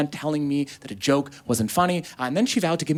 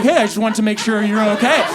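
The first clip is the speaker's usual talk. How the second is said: concerned dad; 8 semitones higher, 8 dB louder than usual. Retold or imitated. Dad checking on teary son.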